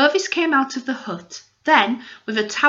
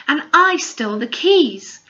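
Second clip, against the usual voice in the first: proud, higher and louder boast; proudly; 6 semitones higher, 6 dB louder than usual. Acted. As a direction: announce it with pride. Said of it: "she added proudly".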